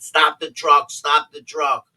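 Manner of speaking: high, loud retold cry; urgent shout. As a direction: call out urgently, reenacting the moment. Shouting to halt the truck.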